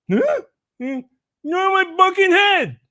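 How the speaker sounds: put-on very high, slurred kid voice on the punchline; cleft lip kid punchline. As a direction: land the punchline in the kid's voice. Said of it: "he kinda talks like this".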